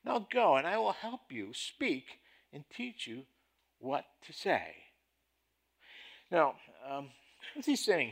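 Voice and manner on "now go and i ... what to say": God's commanding voice, sharper and louder; tense voice of God. Acted. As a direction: press the order with rising tension. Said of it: "a little more tension here in God's voice".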